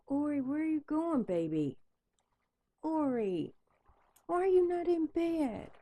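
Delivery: high sing-song calling the dog; talking to a pet in a high coaxing voice. Real, not acted.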